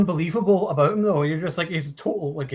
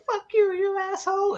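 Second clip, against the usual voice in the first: put-on high cursing old lady voice; foul mouthed granny. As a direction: spit it like a swearing granny. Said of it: "she's like".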